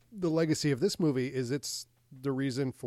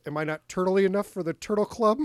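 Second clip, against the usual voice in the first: higher, louder quoted movie line; Dana Carvey line; 6 semitones higher, 7 dB louder than usual. Retold or imitated. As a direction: toss off the famous line as a bit. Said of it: "It was a Dana Carvey".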